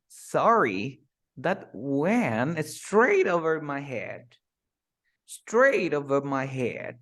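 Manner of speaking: slow, with "straight" punched; slow stressed phrase. Shown as a demonstration. Teacher models the phrase slowly, stressing "straight". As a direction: drag it out, hitting "straight" hard. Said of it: "mira cómo yo lo digo".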